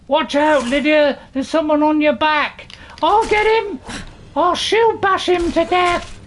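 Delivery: high, loud battle yell; excited gamer yelling over combat, pitch climbing high; shouted warning. Pretend. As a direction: shout urgently to a companion mid-fight. Warning companion during a fight.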